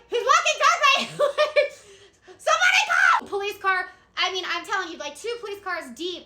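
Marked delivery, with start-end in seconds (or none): 0.1-3.9 high, loud, hammed-up shriek for help; mock panicked scream. Caller as the driver approaches her car, mock panicked scream. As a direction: scream for help, half laughing.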